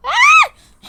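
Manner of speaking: sudden loud, very high shriek; high scream. Acted. Breaking off the repeated okays, high scream. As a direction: burst out screaming.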